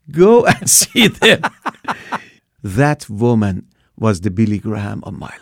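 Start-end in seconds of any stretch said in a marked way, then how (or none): 0.1-1.4 slow, high, loud words through a laugh; elderly church lady sending him to another church, laughing. Retold or imitated. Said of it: "she laughed and she said". 1.5-2.2 high breathy laugh. Retold or imitated. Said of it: "she laughed".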